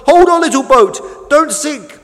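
high, loud plea; urgent calling out. A narrator's reading. Tug races beside the sinking boat, calling urgently. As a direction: call out urgently to someone in danger.

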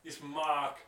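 attempted Boston accent, slow and loud; Mark Wahlberg. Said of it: "gotta talk with a Boston accent".

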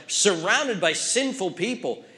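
ordinary delivery

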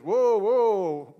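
loud "Whoa, whoa" to halt him; calling out. Retold or imitated. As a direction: shout him down before he reaches the mic. Leader grabs mic, stops him.

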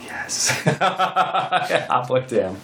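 words drawn out through real laughter; laughing. Real, not acted. Agreeing to the episode's name, laughing. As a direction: crack up while saying it slowly.

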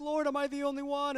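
high, whiny plea to God; mock crying to the Lord. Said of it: "he's crying to the Lord".

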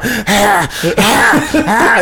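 rising gasping ahs, half laughing; raccoon breathing imitation. Dying raccoon gasping for breath, imitated. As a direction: mimic a dying animal's gasps. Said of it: "It's making this sound".

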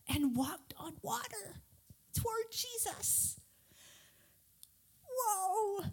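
soft, slow, half-whispered wonder; hushed awe. A narrator's reading. Narrator marvels at Peter walking toward Jesus, hushed awe. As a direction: drop to an amazed near-whisper. Awed retelling of walking on water.

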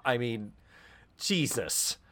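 usual speaking voice

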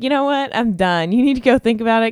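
playful fed-up send-off. Retold or imitated. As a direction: shoo him off with a playful huff. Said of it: "I just wanna be like".

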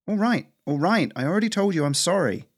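high, defensive squirrel voice; exasperated apology. Acted. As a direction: push back against the scolding. Fed-up apology in quarrel.

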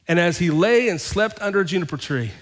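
proclaiming the verse to the congregation; scripture reading. Acted. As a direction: read it out like the prophet's lament. Reads scripture.

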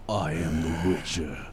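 low, slow, breathy growl; breathy deep voice. Pretend. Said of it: "He does have a breathy voice".

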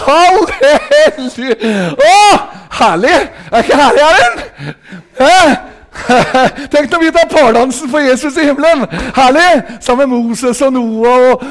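loud, high shouts of delight; ecstatic preaching. Real, not acted. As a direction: exult over heaven at full voice, with a laugh. Exults at full force.

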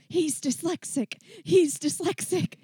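hammed-up frustrated yell, defending the boy; pleading with everybody, high and insistent; mock yell. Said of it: "you just wanna yell".

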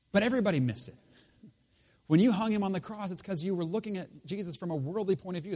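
preaching at full force. Acted. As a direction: drive the point home to the congregation. Preaching climax.

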